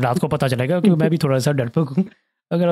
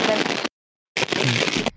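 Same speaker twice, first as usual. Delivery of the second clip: choppy cutting-out line; breaking up space radio; much slower than usual. Real, not acted. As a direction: voice dropping out on a bad connection. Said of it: "तुम्हारी आवाज़ दोबारा cut रही है".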